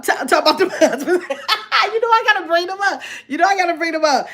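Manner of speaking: high-pitched, laughing through the words; giggly admission, pitched high; laughing, teasing. Real, not acted. Host teasing viewers about the husbands, laughing.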